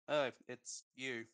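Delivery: deflated and unimpressed; disappointed. Real, not acted. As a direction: say it slowly, sounding let down. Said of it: "sound so disappointed".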